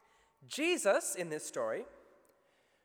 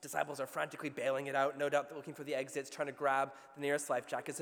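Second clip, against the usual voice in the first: ordinary talk, nothing marked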